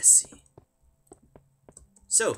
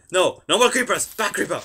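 loud, high-pitched command; raised voice, urgent and high; alarmed shouting; 7 semitones higher, 13 dB louder than usual. Real, not acted. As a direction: shout the monster away. Shouting at attacking creeper.

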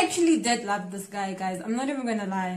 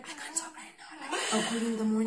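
much softer hushed mock whisper, laughing; imitating a whisper; 9 dB quieter, faster than usual. Boyfriend hushed on the phone, imitated whisper. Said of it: "He's whispering".

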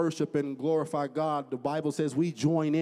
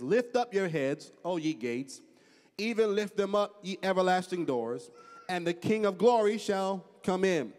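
raised, higher-pitched psalm reading; scripture declamation; 7 semitones higher, 4 dB louder than usual. Acted. Preacher quoting David's psalm to the congregation, declaimed. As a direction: proclaim it like scripture from the pulpit.

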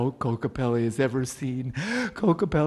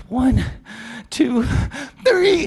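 high, louder and louder count; building count; 10 semitones higher, 7 dB louder, much slower than usual. Acted. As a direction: build up to the jump. Counting down to jump.

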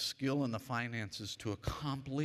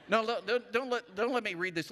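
higher, louder quick take-back; higher excited interruption; excited self-correction; 10 semitones higher, 6 dB louder than usual. Real, not acted. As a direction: catch yourself mid-phrase, fired up. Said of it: "Let me declare this".

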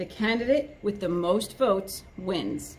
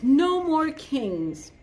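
slow, higher, louder declaration; alligator voice; 6 semitones higher, 4 dB louder, much slower than usual. Acted. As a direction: drag out each word like a big alligator.

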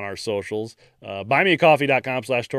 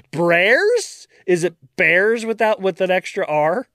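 higher, unsure mispronouncing; retold fumbling; 5 semitones higher, slower than usual. Host reenacts mispronouncing the guest's name, unsure. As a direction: puzzle over the name aloud. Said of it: "Like I did with Sam's last name".

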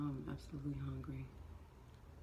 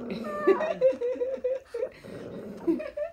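high laugh; 11 dB louder than usual. Real, not acted. Baby laughing/crying.

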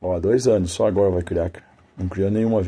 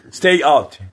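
loud, higher sharp dog command; firm command; 8 semitones higher, 9 dB louder than usual. Real, not acted. Man ordering his dog to stay out, loud command.

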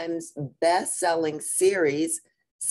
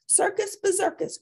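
groaning mama. Acted. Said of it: "groaned Mama".

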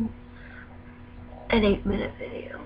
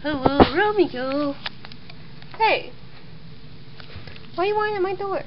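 high pet greeting; 6 semitones higher than usual. Real, not acted. Greeting the dog at door.

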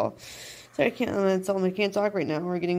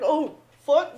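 high, loud sudden dismay; a retold panicked curse, pitched up; mock dismay; 7 semitones higher, 7 dB louder than usual. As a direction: imitate him blurting it in shock. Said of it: "you heard that voice fucking change".

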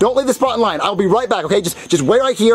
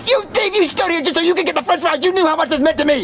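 high-pitched, outraged name-calling; furious accusation; 9 semitones higher than usual. Acted. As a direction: spit the insults at a traitor. Furious at friend over fries.

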